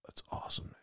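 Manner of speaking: breathy whispered awe; awed whisper. Retold or imitated. Second guy in awe of the deer story, whispering. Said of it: "I'm gonna do both parts".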